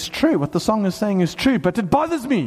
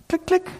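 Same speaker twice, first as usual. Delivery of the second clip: high, crisp "click, click"; vending machine clicks; 11 semitones higher than usual. Retold or imitated. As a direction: imitate a coin clicking in a machine.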